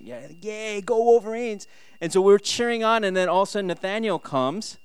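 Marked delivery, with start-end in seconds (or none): 0.0-1.7 retold cheer, rising higher. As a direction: act out a fan cheer, climbing in pitch. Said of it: "we were just cheering".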